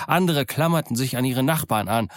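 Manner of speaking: ordinary delivery, nothing marked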